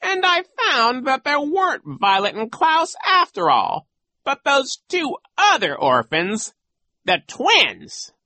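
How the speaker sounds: high, loud, theatrical villain; gloating villain coach. Acted. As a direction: relish the nasty tale. Voices Genghis.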